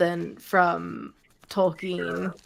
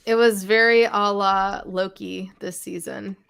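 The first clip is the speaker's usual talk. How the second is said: usual speaking voice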